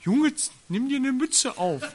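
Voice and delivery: high, loud nagging mum voice, with a laugh; mock mother. Mother nagging her son about his cap, high mock voice. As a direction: play a fussing mother, a bit hammed-up. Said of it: "wenn die Mutter sagt".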